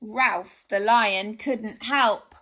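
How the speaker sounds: usual speaking voice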